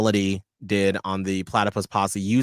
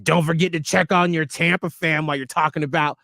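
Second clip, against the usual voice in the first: higher-pitched, pointed nudge; pointed reminder; 6 semitones higher than usual. Shown as a demonstration. Co-host showing another way the listener's comment could sound, pointed. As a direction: read the comment as a pointed dig. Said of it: "it easily also could have been".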